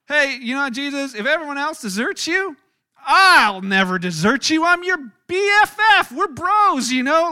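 high, loud, pushy buddy pledge; loud eager vow. Acted. Peter boasting loudly to Jesus that he'll never desert. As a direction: shout your loyalty like his best friend.

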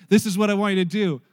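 loud, commanding divine announcement; booming voice from above. Retold or imitated. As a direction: boom it down like a voice from heaven. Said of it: "It's gonna sound like that".

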